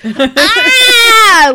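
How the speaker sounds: high mock wail of exhaustion; frustrated wail. Comedian acting out being worn out by late-night sets, high mock wail. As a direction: let out a hammed-up cry of fatigue.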